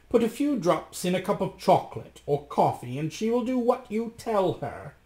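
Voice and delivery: high-pitched scheming magician; Dappertutto. A narrator's reading. Magician explains the potion to Rasmus, high and persuasive. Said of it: "said Dappertutto".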